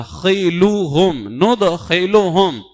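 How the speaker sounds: خلوہم then ندخلہم, very slow and deliberate; slow correct recitation. Shown as a demonstration. Teacher correcting a common misreading, very slowly. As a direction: spell out ندخلہم in slow careful Arabic. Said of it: "اگر اس کو ندخلہم پڑھ بھی دیا".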